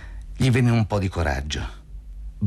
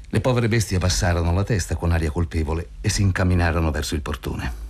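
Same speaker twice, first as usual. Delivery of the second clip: ordinary delivery, nothing marked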